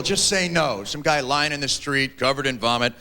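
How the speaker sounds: normal talking voice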